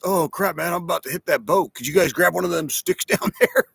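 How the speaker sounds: hammed-up alarm as the boat closes in; mock panic. Friend about to hit a boat, calling for poles in mock panic. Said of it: "you didn't do any of that until you realized".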